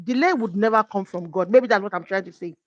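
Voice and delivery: ordinary delivery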